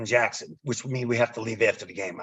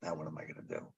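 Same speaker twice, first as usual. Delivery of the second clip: much softer and lower, regret sinking in; dismayed mutter; 10 semitones lower, 21 dB quieter, faster than usual. Retold or imitated. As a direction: mutter it to yourself, suddenly deflated.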